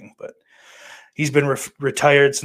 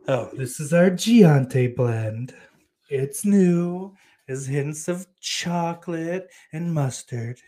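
slow, higher-pitched coffee-ad voice; mock coffee pitch; 5 semitones higher, 4 dB louder, slower than usual. Streamer selling the Giante blend, mock ad voice. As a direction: linger over each flavor like a tasting pitch. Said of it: "sounds like a special coffee".

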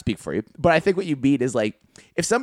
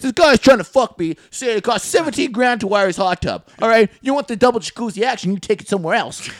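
high loud tradesman rant; 9 semitones higher, 7 dB louder than usual. Acted. Tradesman ranting about a client.

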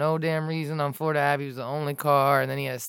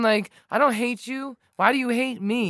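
higher-pitched pleading retort; retold yelling; 7 semitones higher than usual. Cyclist appeals to hostile driver, retold pleading yell. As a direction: re-enact an impassioned appeal, voice raised in pitch. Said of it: "I started yelling at him".

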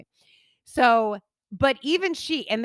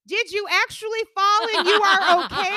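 mock device question, much higher and louder; robotic watch voice; 11 semitones higher, 6 dB louder than usual. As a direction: imitate the watch asking again. Imitating watch's second question.

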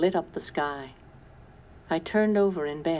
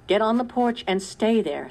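higher, louder firm command; shouted order; 6 semitones higher, 5 dB louder than usual. A narrator's reading. Sarah shouting at Caleb to stay back, firm. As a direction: order him to safety. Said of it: "Sarah shouted at him".

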